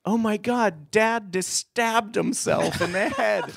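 high, drawn-out mock horror; panicked stage outburst. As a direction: wail it like a flustered actress ad-libbing. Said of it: "woman runs out and says".

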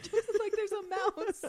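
giggling as you picture it; laughing. Real, not acted. Laughing while describing a scene.

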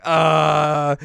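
hammed-up stalled reaction; mock dumbfounded. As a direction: stretch it out like a stunned cartoon character. Character stunned, retold.

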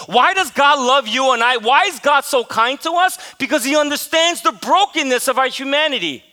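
building, emphatic preacher delivery; impassioned preaching. Real, not acted. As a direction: drive the point home to the congregation. Preaches with rising force.

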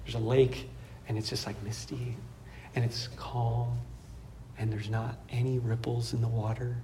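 very soft, low storytelling; hushed calm. Real, not acted. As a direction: paint stillness in a low hush. Evokes misty, eerily calm morning lake.

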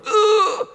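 high, loud "ugh" on impact; acted loud grunt. Preacher as Saul falling off his horse, loud high grunt. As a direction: groan like hitting the ground.

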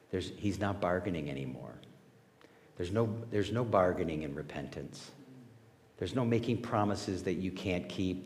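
ordinary talk, nothing marked

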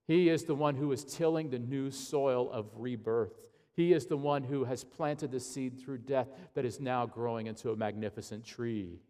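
ordinary talk, nothing marked

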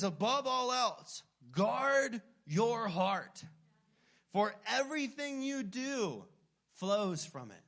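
slow, lifted reading of "guard your heart"; scripture reading. Acted. Preacher quoting Proverbs to the church, slow and solemn. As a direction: read the verse with weight. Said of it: "this is what Proverbs four twenty-three says".

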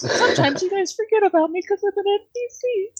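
very high-pitched put-on voice; squeaky high, far above your own voice; NPC woman. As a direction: pitch it way up as a neglected game character. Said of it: "She's like".

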